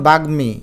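বাগ্মী as "bag-mi", clear m; sounded m. Shown as a demonstration. Teacher showing sounded m, বাগ্মী as "bag-mi". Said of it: "এখানে ময়ের উচ্চারণ হবে".

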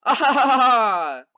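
loud, high spelt-out "ha ha ha"; mock evil laugh. Voicing pranksters who fooled everyone, mock laughing. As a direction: gloat with a put-on laugh. Voices the game's gloating laugh.

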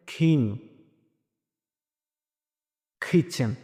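খিন, খিচেন begun with a puffed k; slow, isolated model words খিন, খিচেন; aspirated K. Shown as a demonstration. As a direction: breathe out hard on the first sound of খিন and খিচেন. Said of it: "তাহলে শব্দ আসবে".

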